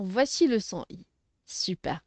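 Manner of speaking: usual speaking voice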